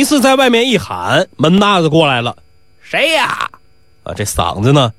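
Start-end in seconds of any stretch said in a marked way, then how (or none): 2.8-3.5 high, hoarse call; hoarse gambler's voice. Acted. Doorman answering Nisi's call, hoarse from gambling. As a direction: call out through a voice worn out by shouting. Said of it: "是赌博时候是喊哑的".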